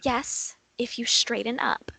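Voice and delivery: hushed. Acted. Mother hushing her child.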